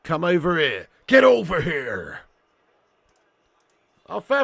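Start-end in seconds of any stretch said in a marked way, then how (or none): 0.0-0.9 loud, commanding referee; shouted mock referee summons; referee's call. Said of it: "and the referee says". 1.1-2.2 loud, drawn-out mock referee shout; hammed-up, long summoning yell; referee's summons. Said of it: "and the referee says".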